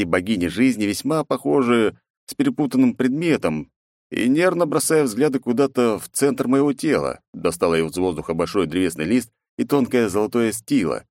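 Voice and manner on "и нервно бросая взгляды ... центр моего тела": usual speaking voice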